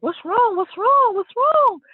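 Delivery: high-pitched imitation of an alarmed wife; retold panicky questioning; worried wife.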